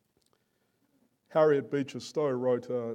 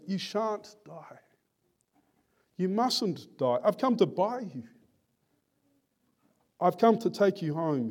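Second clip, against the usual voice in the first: acted pleading; 5 semitones higher than usual. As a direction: plead with a dying friend to hold on. George pleads with dying Tom.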